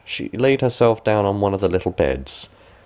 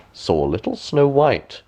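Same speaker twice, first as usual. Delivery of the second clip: usual speaking voice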